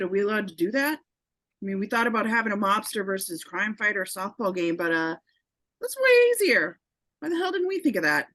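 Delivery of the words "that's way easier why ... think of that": excited. Acted. Delighted mobster realizes the plan.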